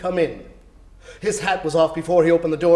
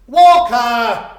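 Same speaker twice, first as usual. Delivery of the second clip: put-on loud, higher boy's jeer; scoffing boy; 6 semitones higher, 10 dB louder than usual. The boy scoffing at Scrooge's offer, loud. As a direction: jeer it in disbelief.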